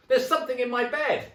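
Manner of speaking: shrieked very high and loud; full panicked shriek; terrified scream. Acted. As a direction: scream for your life. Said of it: "screamed Missus Twit".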